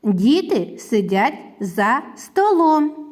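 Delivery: slow sentence, a pause after every word; deliberate, evenly spaced model sentence. Shown as a demonstration. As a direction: set Діти, сидять, за, столом apart. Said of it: "склади речення".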